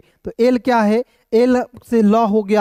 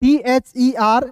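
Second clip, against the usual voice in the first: letters of टीएचईआर spelt very slowly; slow spelling; 2 semitones higher, much slower than usual. Shown as a demonstration. Teacher spells the word slowly to students. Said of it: "देखिए यहाँ पे टीएचईआईआर".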